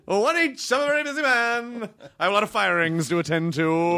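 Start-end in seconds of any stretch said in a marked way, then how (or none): 0.1-1.9 high, hammed-up big shot; put-on busy celebrity; self-important busy man. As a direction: brush off fans in a raised mock voice.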